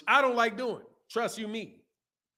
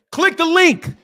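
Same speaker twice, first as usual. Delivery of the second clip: loud plug; 9 semitones higher, 8 dB louder, slower than usual. Real, not acted. Shouting a plug to viewers.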